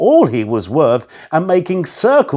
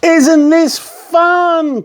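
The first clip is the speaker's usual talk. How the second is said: put-on high, slow, gleeful girl; delighted call; 11 semitones higher, 3 dB louder, much slower than usual. A narrator's reading. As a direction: Katie calls to Mister Whisker. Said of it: "Katie called".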